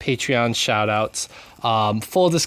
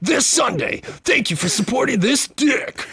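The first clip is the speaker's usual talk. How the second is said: very high, strained event-ad voice; mock hype announcer; 12 semitones higher than usual. Host joining co-host's riff, high mock hype announcer.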